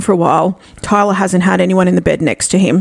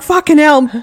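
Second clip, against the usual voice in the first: scared exclamation; 6 semitones higher than usual. Retold or imitated. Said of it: "I'm like".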